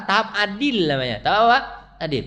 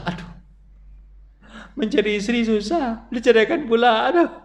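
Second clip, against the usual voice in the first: mock regretful husband; 7 semitones higher than usual.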